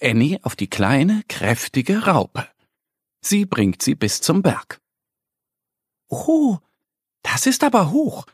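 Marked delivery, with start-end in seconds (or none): 6.1-8.3 high astonished duckling gasp; amazed. A narrator's reading. Annie looks up at the mountain, high and amazed. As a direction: marvel at the height, wide-eyed. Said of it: "sagt Annie ganz erstaunt".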